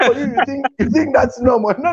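normal talking voice

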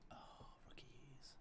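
whisper. Real, not acted. Teasing whisper at teammates.

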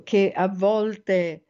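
ordinary talk

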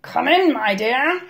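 wolf posing as Granny; scratchy voice. A narrator's reading. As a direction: coax them in with a scratchy voice. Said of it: "scratchy voice that answered".

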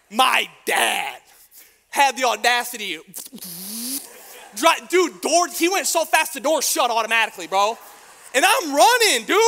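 loud, high, outraged storytelling; telling the church how dad bailed, shouting in mock outrage; incredulous shout. Real, not acted. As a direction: yell it in disbelief. Outraged retelling dad bailing.

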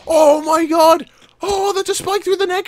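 high, squealing disbelief; appalled high-pitched outburst; horrified amazement. Real, not acted. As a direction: wail it as you spot the damage. Sees spike through neck.

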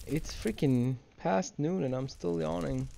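ordinary talk, nothing marked

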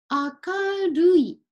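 明るい drawn out very slowly, syllable by syllable; slow teaching read. Shown as a demonstration. Teacher reading 明るい to students, very slow. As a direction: spell out 明るい slowly for learners.

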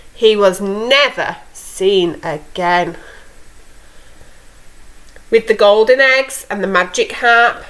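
put-on lively read-aloud for young kids; storytelling to children. A narrator's reading. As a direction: tell it like a bedtime fairy tale. Teacher reads fairy tale aloud.

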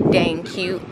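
usual speaking voice

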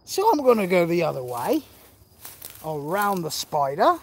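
a loose imitation of an Australian bushman; lightly Australian outback guide, playful; mock Crocodile Dundee. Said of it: "He's like".

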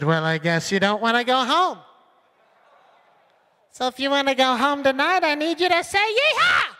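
loud host voice climbing to a high whooping Yeehaw; party driver. Retold or imitated. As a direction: ham up the driver egging on the passengers. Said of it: "She just sat there and said".

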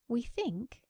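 mildly flustered start; flurried. A narrator's reading. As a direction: begin nervously, a little rattled. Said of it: "in a flurried voice".

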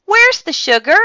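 high, loud call to mom. A narrator's reading. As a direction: call out a question to another room. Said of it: "called out to his mother".

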